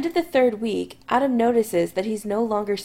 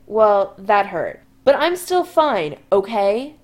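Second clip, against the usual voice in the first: put-on loud drunk insisting; 6 dB louder, slower than usual. Drunk Chris after crashing.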